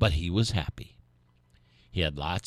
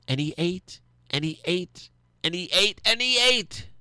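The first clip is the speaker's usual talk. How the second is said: each and he ate climbing higher and louder; building excitement; 11 semitones higher, 4 dB louder than usual. A narrator's reading. Narrator tells how the turkey ate, rising higher and louder each time.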